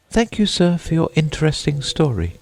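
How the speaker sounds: put-on high girlish voice for Alice; Alice politely. A narrator's reading. Alice thanking the Mock Turtle, in a high polite voice. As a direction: lift the pitch high, polite little girl.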